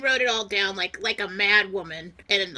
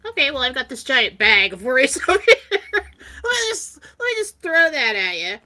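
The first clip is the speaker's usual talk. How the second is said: high, louder, breaking into laughter; laughing mock burden-dumping; 5 semitones higher, 5 dB louder than usual. She jokes about throwing her worries at him, laughing. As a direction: offload it with a laugh.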